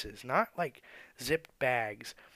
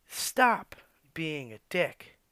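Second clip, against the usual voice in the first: usual speaking voice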